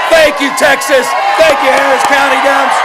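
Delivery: loud, high shouted thanks; rally speech. Acted. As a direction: thank the cheering crowd. Thanks crowd at rally end.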